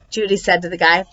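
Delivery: plain reading voice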